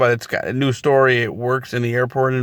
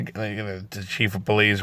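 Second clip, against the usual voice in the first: ordinary talk, nothing marked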